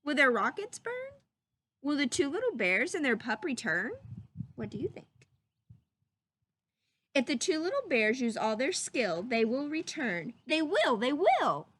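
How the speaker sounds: ordinary delivery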